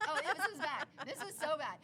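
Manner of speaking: loud, high, laughing recollection. Real, not acted. Recounting her bowling fall to a friend, laughing. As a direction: laugh through the words. Storyteller laughing at own mishap.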